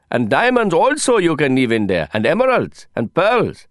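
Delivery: put-on excited Indian man, Indian accent; proud Indian accent, rising indignation. Johnny keeps listing treasures.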